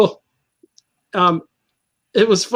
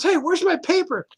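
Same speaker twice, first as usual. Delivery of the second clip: high-pitched complaining quote; indignant caller, acted lightly; mock demand; 9 semitones higher, 3 dB quieter than usual. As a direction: imitate an impatient demand without shouting. Acting out Tex demanding his paper.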